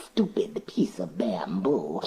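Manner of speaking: a hushed, hissing serpent, slow at first; breathy whispered snake voice; whispering snake. Acted. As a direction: hiss it low like a proud snake.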